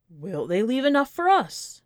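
ordinary delivery, nothing marked

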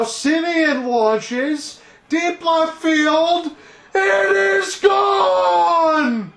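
high, loud, stretched-out shout; home run call. Acted. Announcer calling Semien's blast to left for the viewers, high slow shout. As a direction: build the call up and hold "gone".

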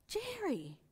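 high, hushed gasp of the name; amazed realisation. Retold or imitated. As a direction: say his name in stunned wonder. Realising she stayed calm.

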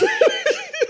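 a sudden shrieking burst of laughter; real squeal of laughter at herself; high squealing laugh. Real, not acted. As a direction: crack up high and loud. Laughs at own bad accent.

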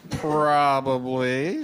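slow teasing probably; drawn out. Acted. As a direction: stretch it out slyly. Sly drawn-out aside.

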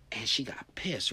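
ordinary talk

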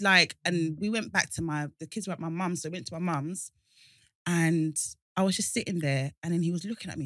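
ordinary talk

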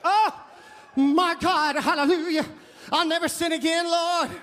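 very high, loud hallelujah shouts; hammed-up revival rapture; shouting thanks to the Lord at the top of the voice; ecstatic praise. Ecstatic praise after baptism.

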